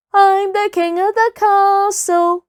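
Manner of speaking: high, loud playground chant; sing-song taunt; childish taunt. Acted. Playful chant in a story.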